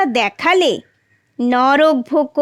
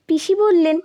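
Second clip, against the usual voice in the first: ordinary delivery, nothing marked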